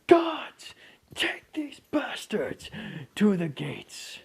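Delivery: bellowed high order to the guards; king ordering guards, furious then cold; commanding roar. Acted. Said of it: "and he says".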